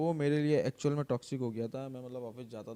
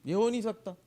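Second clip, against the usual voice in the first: higher and louder, scoffing disbelief; retold boss mocking loudly; 6 semitones higher, 4 dB louder than usual. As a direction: scoff at him like a boss who thinks he's lost it. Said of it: "तब ने मुझे बोला कि".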